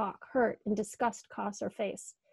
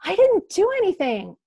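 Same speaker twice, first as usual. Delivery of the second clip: much higher, louder hurt cry; voiced Kate protesting, high and strained; whisper yelling; 10 semitones higher, 11 dB louder than usual. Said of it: "She's still whisper yelling".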